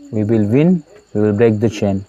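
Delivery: ordinary talk, nothing marked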